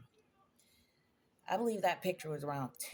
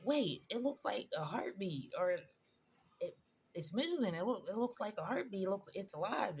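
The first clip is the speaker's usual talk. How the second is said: excited; 5 semitones higher than usual. Retold or imitated. Excited at the ultrasound.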